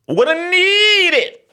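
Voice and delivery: high, loud, long-stretched NEED; hammed-up high holler; comic shout. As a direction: yell it high, dragging out NEED. Repeats the line as a shout.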